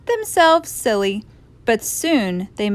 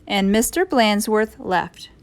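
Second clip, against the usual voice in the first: ordinary delivery, nothing marked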